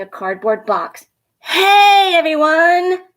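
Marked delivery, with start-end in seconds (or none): 1.4-3.0 high, loud calling out; excited yell. A narrator's reading. Dad calling the family to see the puppy, loud and high. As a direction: call the whole family over, thrilled. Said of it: "he yelled".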